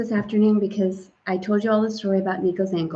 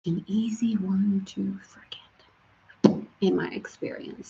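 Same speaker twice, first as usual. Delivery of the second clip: whisper; 7 dB quieter, slower than usual. Real, not acted. Whispered aside.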